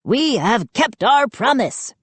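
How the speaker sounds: high-pitched gnome proclamation; high, ceremonious gnome voice; declaring gnome. A narrator's reading. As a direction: declare it to the whole crowd. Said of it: "Chip declared".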